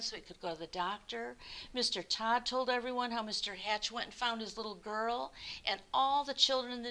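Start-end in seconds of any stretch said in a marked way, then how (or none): none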